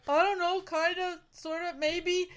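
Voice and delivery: high, shy hesitant mumble; sheepish high voice. Retold or imitated. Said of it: "he's like".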